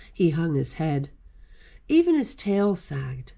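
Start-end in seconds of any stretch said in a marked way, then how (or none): none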